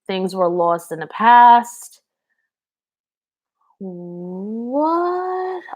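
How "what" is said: high, drawn-out rising sarcastic "what". Real, not acted. Host reacting to a question from the chat, sarcastic. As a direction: stretch it upward in a put-on sarcastic voice. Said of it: "it's super sarcastic".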